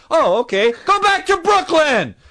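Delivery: very high, loud fed-up yell; exasperated yell. Acted. Yelling at the imagined Brooklyn guy to go home, loud and exasperated. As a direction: shout him back home.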